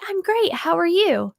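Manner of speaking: high, upbeat reply; matching high energy. Shown as a demonstration. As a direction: mirror an excited greeter's energy. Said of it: "I'm mimicking the energy".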